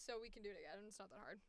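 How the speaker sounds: normal talking voice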